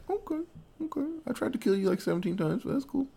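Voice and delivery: high and soft, sheepishly casual; hammed-up breezy forgiveness; squeaky meek shrug of a voice; mock forgiving villain.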